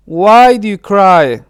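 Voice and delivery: ordinary delivery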